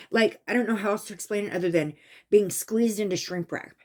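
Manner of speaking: ordinary talk, nothing marked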